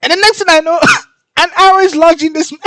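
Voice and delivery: high-pitched, loud laughing retelling; incredulous laughing. Real, not acted. Reader recounting the arrow lodged in this man, incredulous laughing. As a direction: force the words out through laughter.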